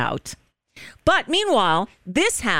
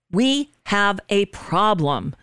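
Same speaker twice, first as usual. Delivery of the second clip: ordinary talk, nothing marked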